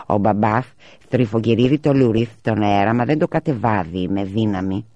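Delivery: put-on boy's lisp, s turned to th; lightly voiced lisping little brother; lisping child. A narrator's reading. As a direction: say μπαμπάς as "μπαμπάθ", lisping. Boy speaks with food in mouth.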